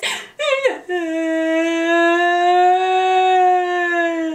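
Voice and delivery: put-on high wailing baby cry; imitated baby crying. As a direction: wail like a crying toddler. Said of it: "you know how babies cry like this?".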